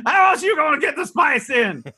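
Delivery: high-pitched hammed-up hunter voice; mock swamp hunter. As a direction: plead the hunter's case indignantly. Continuing hunter bit.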